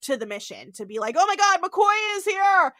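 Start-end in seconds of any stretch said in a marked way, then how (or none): 1.2-2.7 high, loud frantic cry; hammed-up panicked excitement; acted freak-out. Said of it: "He's, like, freaking out".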